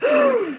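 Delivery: high sharp gasp of shock. Retold or imitated. First lady gasps at the wife's words, high sharp gasp. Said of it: "First Lady said, gasps".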